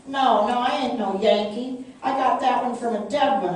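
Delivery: loud, high, frantic denial; boy sputtering lies to the troopers. A narrator's reading. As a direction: sputter it out in panic. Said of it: "I sputtered".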